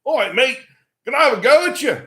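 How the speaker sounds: put-on high, loud Australian accent; cocky challenge in a mock Aussie accent; imitated Australian kid. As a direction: play an Australian kid calling someone out. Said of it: "the sort of leader of the, of the group looks at me and he goes".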